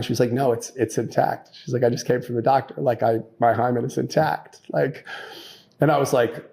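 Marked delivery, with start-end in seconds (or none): none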